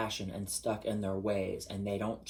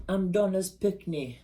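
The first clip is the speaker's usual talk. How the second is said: high, slow, louder Jamaican patois; Jamaican accent; 9 semitones higher, 5 dB louder than usual. Retold or imitated. Showing off Jamaican patois, Jamaican accent. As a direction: say it like a Jamaican child. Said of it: "Jamaica was owned by the English".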